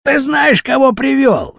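high, pressing question; confronting a henchman. A narrator's reading. Rashpil questions Baton about whom he brought, confronting. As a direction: demand it, voice pitched well up.

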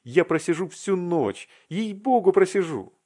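high, excited promise; acted breathless-with-eagerness insistence; fervent vow. Vasya vows fervently after jumping up.

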